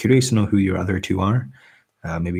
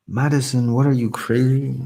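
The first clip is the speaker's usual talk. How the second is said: surprised; 6 semitones higher, 4 dB louder than usual. Real, not acted. Big donation read out.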